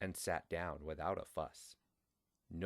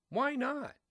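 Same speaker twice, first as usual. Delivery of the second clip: mischievous why not; 8 dB louder than usual. A narrator's reading. Narrator voices Frank's greed.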